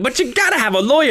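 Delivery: high, quick exasperated insistence; acted judge. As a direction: plead the rule as a flustered judge. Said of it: "And he's like".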